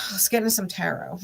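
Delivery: ordinary talk, nothing marked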